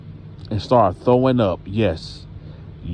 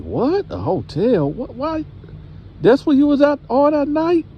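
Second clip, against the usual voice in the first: high, incredulous acted questions; shocked; 14 semitones higher than usual. Grandma hears of the hotel, shocked and high-pitched. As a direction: retell it with a mother's shocked squeak.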